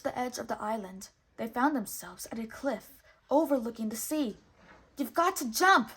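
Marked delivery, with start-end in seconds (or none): 5.0-5.9 loud high urgent yell. A narrator's reading. Jiminy urging Pinocchio to leap off the cliff, loud high yell. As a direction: yell it over the cliff edge. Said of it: "yelled Jiminy".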